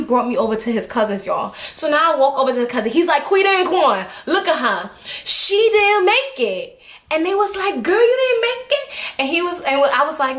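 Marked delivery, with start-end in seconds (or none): 3.2-6.8 high, drawn-out announcement; calling to cousins. Retold or imitated. Boy calls cousins over with news. 7.8-8.9 high, rising disbelief; incredulous reaction. Retold or imitated.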